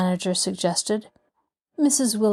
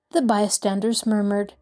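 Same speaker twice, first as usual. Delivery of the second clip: ordinary delivery, nothing marked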